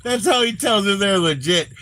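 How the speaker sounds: laughing hard through the words. Real, not acted. Host cracking up at a police Spanish course, laughing. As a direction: squeeze the words out between laughs.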